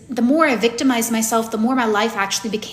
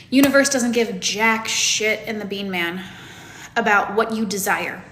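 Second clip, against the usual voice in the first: ordinary talk, nothing marked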